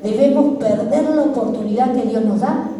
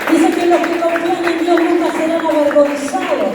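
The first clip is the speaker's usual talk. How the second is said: fervent preaching; 5 semitones higher than usual. Real, not acted. Preaching at full force after applause.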